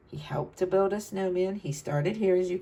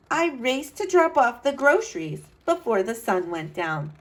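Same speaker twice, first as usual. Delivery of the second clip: put-on bright, lively read-aloud to kids; storybook narration; 5 semitones higher, 6 dB louder than usual. A narrator's reading. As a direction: tell it like a storyteller for children. Reads picture book aloud.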